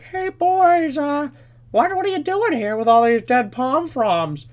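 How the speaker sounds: put-on high, drawn-out questioning of the boys; suspicious troop leader. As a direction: imitate a troop leader sniffing out trouble. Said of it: "the troop leaders start getting suspicious".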